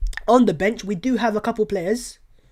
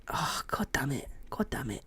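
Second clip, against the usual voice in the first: under-breath God damn it; whispered curse; 6 semitones lower, 15 dB quieter than usual. Real, not acted. Fan muttering a curse under his breath. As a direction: hiss the curse almost silently.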